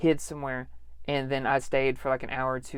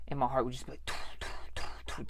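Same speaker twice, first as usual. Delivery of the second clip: whispered thumping heartbeat noise; imitated heartbeat; 2 semitones lower, 7 dB quieter, slower than usual. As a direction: tap out a racing heart under the breath.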